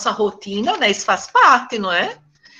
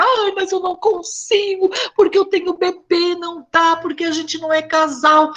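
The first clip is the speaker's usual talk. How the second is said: imitating the mother, higher and tearful, half-crying; mãe chorando; 7 semitones higher than usual. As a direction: play the overwhelmed mother about to cry. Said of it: "E a mãe já começa a chorar". (imitando a mãe, mais aguda, quase chorando)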